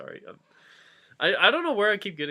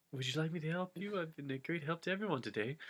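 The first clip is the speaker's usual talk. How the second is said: quick mumbled re-read; 4 semitones higher, 11 dB quieter, faster than usual. Acted.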